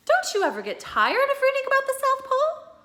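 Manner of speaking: a high, exasperated housewife; wife's high voice. Acted. Wife needling her book-loving husband, high and exasperated.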